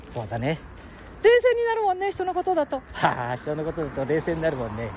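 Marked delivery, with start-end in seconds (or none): 1.2-2.8 put-on high squeaky boy voice; cheeky little kid, much higher; Ken-chan voice.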